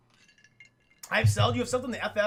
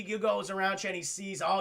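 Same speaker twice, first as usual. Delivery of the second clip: ordinary delivery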